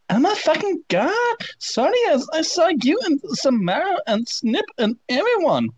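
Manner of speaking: very high, excited squeal; squealing fangirl voice. Acted. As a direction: shriek it like a gushing teen. Reading fanfic in shrill voice.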